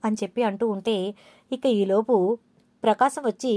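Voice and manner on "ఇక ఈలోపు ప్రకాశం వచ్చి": plain reading voice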